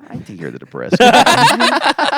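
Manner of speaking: slow, high, laughing through it. Real, not acted. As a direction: giggle the words out slowly. Teasing a friend, laughing.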